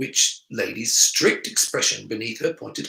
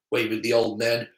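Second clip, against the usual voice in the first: ordinary delivery, nothing marked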